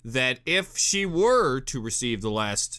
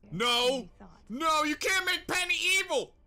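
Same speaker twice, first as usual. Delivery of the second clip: squeaky, much higher cry of dismay; high protest. Real, not acted. Begging the show to spare Penny, high dismayed cry. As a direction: plead with the show not to do it.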